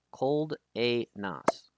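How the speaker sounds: slow "Cold A Nas", in separate parts; name sounded out. Shown as a demonstration. Streamer trying out a player's name, sounded out slowly. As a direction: break "Cold A Nas" into cold, ay, nahs. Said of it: "If I'm pronouncing it wrong".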